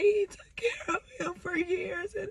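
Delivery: real sob in the voice; voice breaking. Real, not acted. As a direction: let the voice crack into tears. Voice breaks crying.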